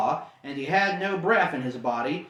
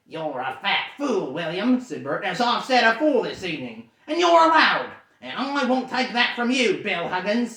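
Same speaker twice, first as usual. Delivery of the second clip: louder, higher furious troll; angry troll; 4 semitones higher, 4 dB louder than usual. Acted. Troll Bert insulting William, angry. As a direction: pick the fight. Trolls quarrel before fight.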